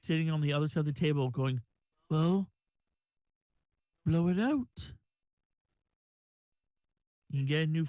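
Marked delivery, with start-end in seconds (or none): 2.1-4.9 flat drow voice, lightly raised; deadpan and level, a hint of impatience; monotone voice. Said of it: "the monotoned albino drow".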